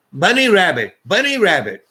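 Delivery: high, loud dazed sing-song; stoned goofy reply. Retold or imitated. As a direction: chant it like a stoned kid. Stoned speaker answers stranger.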